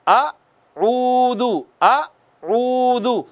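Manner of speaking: अऊदु drawn out slowly, lips rounded on the u; slow tajweed chant of अऊदु with rounded lips; Quranic recitation. Shown as a demonstration. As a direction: recite अऊदु slowly, stretching the first vowel. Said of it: "होंठ गोल करके पढ़े".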